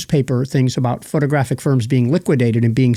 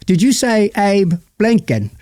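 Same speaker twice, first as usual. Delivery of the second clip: slower, higher British movie voice; film's accent; 7 semitones higher, slower than usual. Retold or imitated. Host quoting the real movie line in its accent. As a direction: say it the way the film really did. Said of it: "But no, he says".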